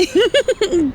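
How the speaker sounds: short high giggle; giggling. Acted. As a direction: let out a light giggle. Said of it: "Eloise giggled".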